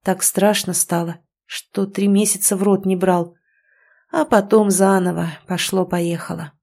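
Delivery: plain reading voice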